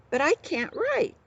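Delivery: a spoiled prince blurting, voice jumping up; blurted out. A narrator's reading. Prince blurts his confession to the outlaw, high. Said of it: "blurted out Prince Brat".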